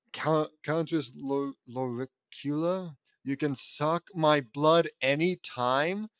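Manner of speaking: put-on slow, drawn-out Transylvanian accent; a light Transylvanian accent, slowly. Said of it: "I say in a Transylvanian accent".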